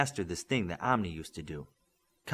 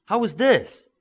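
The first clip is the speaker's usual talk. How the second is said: high and beaming; bursting with joy; absolute happiness; 12 semitones higher, 9 dB louder, slower than usual. A narrator's reading. Midas showing his glowing eyes, beaming with joy. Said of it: "Midas said with absolute happiness".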